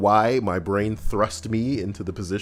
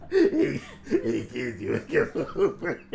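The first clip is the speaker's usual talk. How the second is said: put-on laughing leering old lecher; creepy come-on to kids, giggling; dirty old man; 3 dB quieter than usual. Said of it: "dirty old man".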